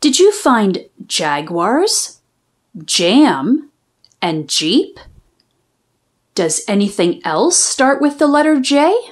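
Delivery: put-on gentle teacher quizzing young readers; teacher asking children. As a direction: coax little ones to look and answer.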